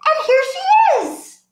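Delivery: high, laughing little bear voice. Acted. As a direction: squeal it like a giggling baby bear. Bear voice in Goldilocks-style story.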